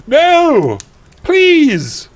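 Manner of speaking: very high, loud melodramatic plea; begging. Acted. Heroine begging Voldemort, hammed-up pleading cry. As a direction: wail it out, hammed-up desperation. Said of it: "I begged".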